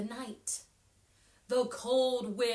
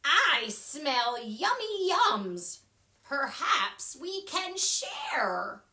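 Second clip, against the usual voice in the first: slow, louder badger voice; lightly voiced badger; 4 semitones higher, 9 dB louder, slower than usual. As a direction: savor it like a hungry badger. Badger character speaking.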